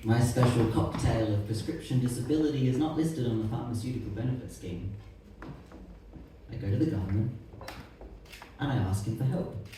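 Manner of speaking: quiet poem reading. Acted. Poet reading a protest poem to the audience, quiet and controlled. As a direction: lay out each hard fact softly and evenly.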